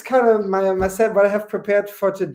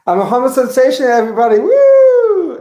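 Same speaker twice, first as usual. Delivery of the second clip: louder, higher, with a whooping woo; showy cheer; 6 semitones higher, 6 dB louder than usual. Real, not acted. Comic to the crowd, a loud whooping self-celebration. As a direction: sell it like a party announcement.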